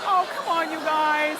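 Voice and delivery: mock protest. Real, not acted. Teasing reaction to audience.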